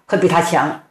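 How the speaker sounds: ordinary delivery, nothing marked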